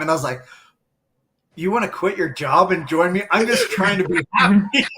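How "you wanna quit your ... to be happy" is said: higher, louder, amused incredulous reply; retelling his startled reaction to the partner; mock disbelief. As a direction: play up the shock with a laugh. Retold reaction to partner's offer.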